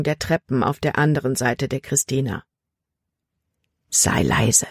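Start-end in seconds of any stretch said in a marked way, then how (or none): 3.9-4.7 urgent low whisper; father whispering to his daughter. A narrator's reading. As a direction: hush her with a whispered warning. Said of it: "flüsterte er".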